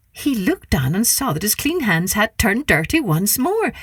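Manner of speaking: ordinary delivery, nothing marked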